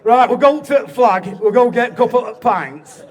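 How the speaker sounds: slow, booming bad Bolton accent; terrible Bolton accent. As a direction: belt it out in a broad mock Bolton accent. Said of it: "This is a terrible accent".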